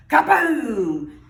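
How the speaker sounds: booming sound-effect word; loud kaboom. Acted. Narrator booming the rock's magic blast, loud. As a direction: blast it out like an explosion. Magic rock knocks Elephant down.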